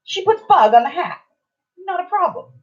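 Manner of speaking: high, sing-song storytelling emphasis; pointedly breezy. Real, not acted. Telling how the clerk accepted odd names, pointedly breezy. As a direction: lift it high to show how easy it was.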